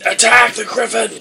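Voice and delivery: high, loud shouted order; battle command. Acted. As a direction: shout the charge to your team. Elf orders the team to attack.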